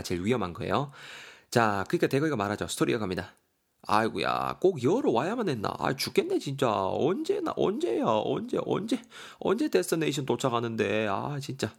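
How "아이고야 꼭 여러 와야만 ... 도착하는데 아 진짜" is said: high, whiny, swooping complaints; 현식, exasperated hiker. Acted. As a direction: whine about the endless climb. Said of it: "현식이가 말합니다".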